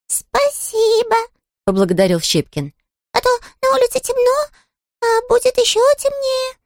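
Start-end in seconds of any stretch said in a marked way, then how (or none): 0.1-1.2 very high, tiny sulky voice; a small touchy critter, piping high; squeaky little creature. Acted. Щепкин's character voice. 3.1-6.6 very high, grumbling little creature. Acted. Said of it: "ворчливо добавил он".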